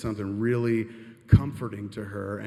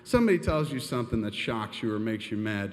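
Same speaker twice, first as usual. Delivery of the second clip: normal talking voice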